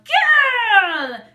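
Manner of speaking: huge high drawn-out bellow; long falling shout; witch's yell. Acted. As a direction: holler for a servant at full volume. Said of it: "Baba Yaga trotted out of the room and yelled".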